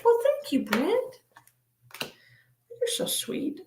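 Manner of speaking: sweet thanks. Real, not acted.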